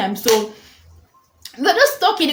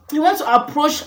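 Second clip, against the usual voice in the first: ordinary talk, nothing marked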